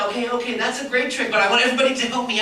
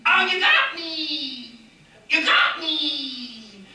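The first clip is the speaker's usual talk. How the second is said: higher slow dying cry; puppet shot dramatically; 6 semitones higher, much slower than usual. Acted. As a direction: ham up being shot. Puppet Chico pretends to be shot.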